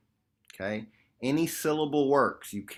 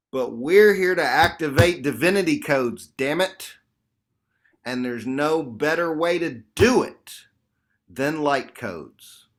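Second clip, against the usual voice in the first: fired up; 7 dB louder than usual. Real, not acted. Fired-up teacher rallying group.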